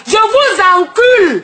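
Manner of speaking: angry shout; angry outburst. Real, not acted. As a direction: spit the insult out at the haters. Insulting her critics.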